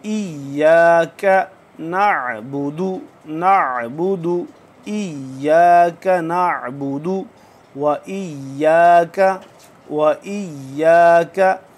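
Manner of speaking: इय्याका with a pressed, held doubled य, slow; stressed doubled ya. Shown as a demonstration. Teacher drills इय्याका for students, pressing the shadda. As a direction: lean on the य in इय्याका. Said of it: "ज़ोर लगाएंगे कि ये तशदीद है".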